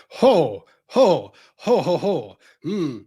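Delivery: ordinary talk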